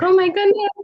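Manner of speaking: high, loud squeal of delight; friend losing it. Retold or imitated. Imitating her friend seeing a puppy, squealing high. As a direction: gush like you just saw a puppy. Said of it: "my friend lost it".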